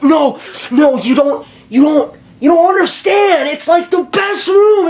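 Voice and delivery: overjoyed high-pitched exclaiming; ecstatic shout. Retold or imitated. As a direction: burst out like you just found paradise. Insisting to mom.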